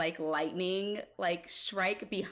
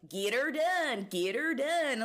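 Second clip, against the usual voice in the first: a light southern twang on get her done; half-hearted southern accent impression; imitating Travolta accent; 8 semitones higher, 3 dB louder, slower than usual. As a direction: mimic the movie's drawled catchphrase, lightly. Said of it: "he does the whole".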